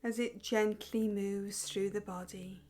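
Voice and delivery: slow, soft guiding voice with long pauses; guided meditation. Acted. Teacher guiding viewers through breathing exercise, slow calm meditation voice. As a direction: lead the breath gently and unhurriedly.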